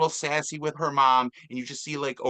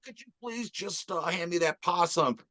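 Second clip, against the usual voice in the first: Brenda voice; 4 semitones higher, 3 dB quieter than usual. Retold or imitated. Quoting Brenda asking for possum.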